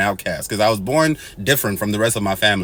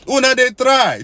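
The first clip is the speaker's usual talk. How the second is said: much higher, louder pidgin exclaim; exclamation incrédule; 12 semitones higher, 4 dB louder than usual. Real, not acted. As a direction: marvel aloud at them in disbelief.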